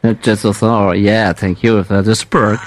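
lightly put-on French-accented English, hesitant; prank caller. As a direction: play a clumsy foreign caller, stumbling through English. Prank call in broken English.

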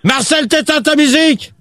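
high-pitched, impatient command; exasperated order. Real, not acted. Host orders music off. (ordre exaspéré, voix montée)

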